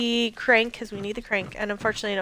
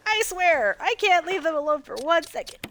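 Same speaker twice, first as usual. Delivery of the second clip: exasperated Brad; 8 semitones higher, 3 dB louder than usual. Retold or imitated. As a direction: grumble like a pilot losing his team. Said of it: "imagine just Brad ... like".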